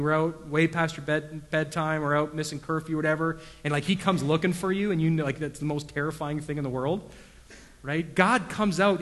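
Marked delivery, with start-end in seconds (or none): none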